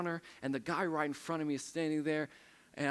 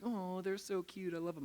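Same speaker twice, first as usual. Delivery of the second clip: mock gushing; 6 semitones higher, 3 dB quieter than usual. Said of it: "everybody else is like".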